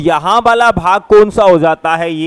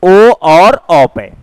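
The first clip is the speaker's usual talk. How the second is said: ओ और औ drawn out and louder; slow, louder vowels; 5 dB louder, much slower than usual. Shown as a demonstration. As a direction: stretch ओ and औ loud for the class. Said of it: "अपन बात कर रहे हैं किस पर". (ओ और औ ज़ोर से खींचकर)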